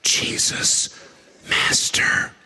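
hushed whisper to a sleeping man. Acted. Disciple nudging sleeping Jesus, whispering. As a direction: breathe it so you don't wake him fully. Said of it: "one of them reaches over and nudges him".

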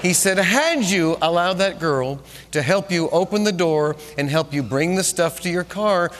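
normal talking voice

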